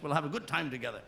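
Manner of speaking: ordinary delivery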